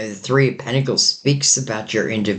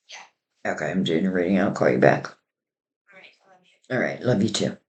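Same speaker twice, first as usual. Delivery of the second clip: turning away from phone; 3 semitones lower, 4 dB quieter than usual. Real, not acted. Phone call aside during the reading.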